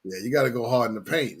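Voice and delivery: usual speaking voice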